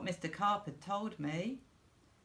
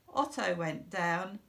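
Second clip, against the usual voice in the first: plain reading voice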